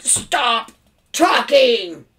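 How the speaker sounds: loud, punched two-word order; bellowing. A narrator's reading. Father cutting off his chattering son, bellowing. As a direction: roar it to cut him off. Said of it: "bellowed his father".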